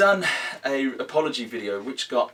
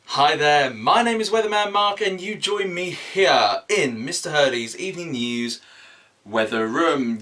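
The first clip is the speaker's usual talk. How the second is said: louder, higher, bigger weatherman intro; emphatic presenter voice; 5 semitones higher, 6 dB louder than usual. Shown as a demonstration. Weatherman Mark restarting his intro with more emphasis. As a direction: sell it like a TV weatherman. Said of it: "put more emphasis in it".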